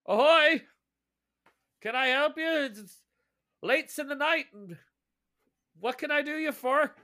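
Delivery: high, loud watchman's hail; ship's guard hailing. Acted. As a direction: call out across the deck as a lone night guard. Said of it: "and he's like".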